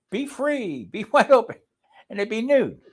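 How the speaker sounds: high-pitched, gleeful whoop of freedom; airy, hammed-up liberation cry; gleeful free-spirit call. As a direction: call it out high, half laughing. Hammed-up, laughing retelling of camp.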